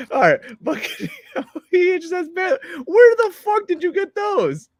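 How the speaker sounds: high cracking yell through laughter; laughing shout. Real, not acted. Host cracks up at co-host's shoulder pads, shrieking through laughter. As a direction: shriek it while losing it laughing.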